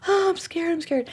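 small, high frightened voice; high-pitched, timid retelling of fright; acted scared. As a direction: shrink back in mock fear. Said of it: "and I'm like".

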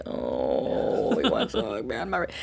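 low, soft, timid worry; shy toddler voice. Retold or imitated. Daughter shrinking from the hug, low and soft. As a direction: whimper out a child's reluctance. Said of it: "And she'd be like".

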